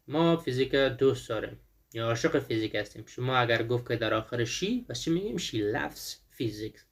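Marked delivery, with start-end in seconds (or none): 5.4-6.8 slow, careful model sentence; slow model answer. Shown as a demonstration. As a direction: say the answer slowly for students to copy.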